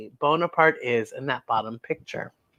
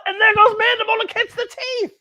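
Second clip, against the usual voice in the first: high and quick, thrilled; excited telling; faster than usual. Real, not acted. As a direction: rush it out in delight. Excited narration of picture.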